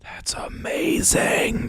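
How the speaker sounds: high, slow breathless whisper; awed whisper. Acted. Shopper whispering in awe about the Red Bulls.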